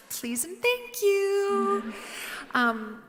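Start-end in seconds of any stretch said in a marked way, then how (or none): 0.1-1.9 saccharine politeness. Retold or imitated. Idealistic teacher mocking own sweetness.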